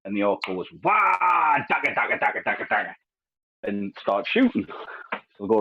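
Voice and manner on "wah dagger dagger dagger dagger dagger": high whooping "Wah!" then a chanted dagger; a mock orc charging in; a hint of a battle-crazed orc; orc war cry. Orc voiced in retold joke.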